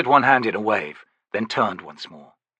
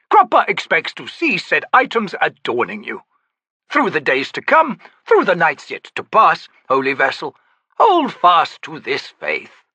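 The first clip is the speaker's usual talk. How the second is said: lofty, theatrical, higher; grand old Daru; 8 semitones higher, 5 dB louder than usual. Acted. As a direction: play the eccentric old trickster. Cropper blessing.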